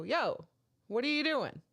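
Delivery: mildly indignant calling-out; retold telling-off. Host catches a comedian behind the bar, mild retold yell. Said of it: "I had to yell at him".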